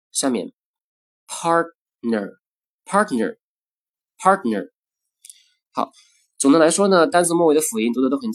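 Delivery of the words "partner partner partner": partner with the t barely released; weakened t. Shown as a demonstration. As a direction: soften the t in partner. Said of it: "失去爆破".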